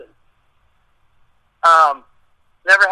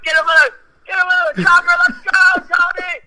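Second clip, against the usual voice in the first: war-zone yell; 11 semitones higher than usual. Retold or imitated. Soldiers pulling a wounded man from the chopper, yelling. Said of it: "I felt like I was, like, in war".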